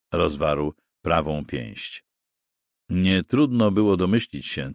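ordinary delivery

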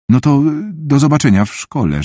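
stall, then say it unsurely; uneasy, tentative farewell; uncertain. A narrator's reading. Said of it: "rzekła niepewnie".

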